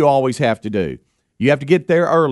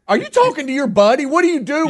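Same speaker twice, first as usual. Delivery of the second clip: incredulous; 14 semitones higher than usual. Retold or imitated. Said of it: "And I'm like".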